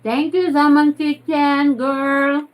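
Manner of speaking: bright, loud, high thank-you; excited thanks. Real, not acted.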